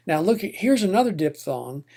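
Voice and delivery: usual speaking voice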